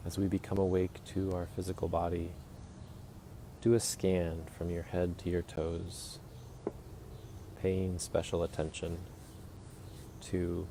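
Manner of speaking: soft, unhurried meditation guide voice; guided meditation. Acted. Guiding listeners through a morning body scan, soft and calm.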